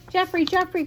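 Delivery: high, eager call. A narrator's reading. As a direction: call out to the groundhog. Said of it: "called Merton".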